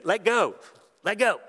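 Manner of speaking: put-on high, loud, gleeful "let go" like a giggling toddler; thrilled kid begging. Little son begging dad in the wave pool, high and gleeful. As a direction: bounce it up high like a little boy loving the waves.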